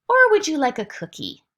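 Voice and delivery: high, loud sing-song offer; parentese. Shown as a demonstration. Parent offering a baby a cookie in sing-song. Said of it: "the mother or the father will say".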